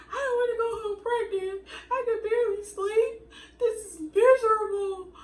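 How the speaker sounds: high, loud crying through the words; retold sobbing. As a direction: sob it out, voice breaking high. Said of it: "but I started crying again".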